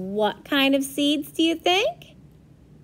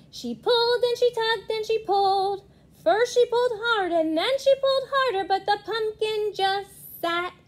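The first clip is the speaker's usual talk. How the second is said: effortful, rising storytelling; 7 semitones higher, faster than usual. A narrator's reading. Strenuous pulling narrated.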